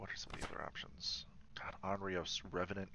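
hushed whisper. Real, not acted. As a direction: whisper the options to yourself. Hushed while ghost hunting.

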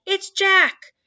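loud, high panicked bellow; high-pitched giant's roar. A narrator's reading. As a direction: bellow it in sheer terror. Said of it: "bellowed the flesh lump eater".

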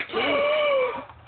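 high squealing gasp; shrill girly gasp; delighted gasp. Retold or imitated. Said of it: "I'm like".

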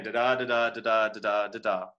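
"da, da" in five unstressed-stressed pairs; iambic rhythm. Shown as a demonstration. Teacher demonstrating iambic pentameter to the class, iambic rhythm. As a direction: chant "da, da" evenly, leaning on every second da. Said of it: "classical notation of iambic pentameter".

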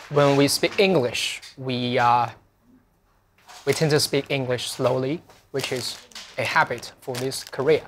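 unhurried, deliberate English; measured classroom pace; slow teacher English. Shown as a demonstration. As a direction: explain slowly for learners of English. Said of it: "很多老师说英语其实是说得慢的".

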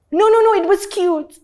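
high, loud imitated mocker; mock placating. Laughers telling her it was cute, mock placating. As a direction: put on a high voice, falsely reassuring.

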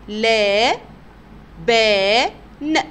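لا، با، ن loud and separate, long "laa, baa", short "n"; teacher loudly spelling لا، با، ن with gaps. Shown as a demonstration. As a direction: sound it out syllable by syllable. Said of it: "تعالوا نتهجى كده مع بعض".

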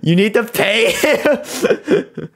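high, slow words through laughter; laughing. Real, not acted. Etho laughs telling chat to pay his friend, laughing. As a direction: barely get it out for laughing.

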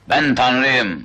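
low, pompous god voice; self-proclaimed god. Acted. Tourist playing god to the tribe, low solemn proclamation. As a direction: proclaim it deep down in pitch.

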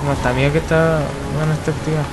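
ordinary delivery

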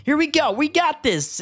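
high-pitched, eager cheer; excited self-encouragement. Real, not acted. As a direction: hype yourself up with a high lift. Hypes self through the vent.

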